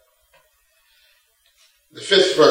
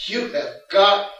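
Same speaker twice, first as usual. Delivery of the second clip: imagined Moses disbelief; 6 semitones higher, slower than usual. Retold or imitated. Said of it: "I'm sure Moses said".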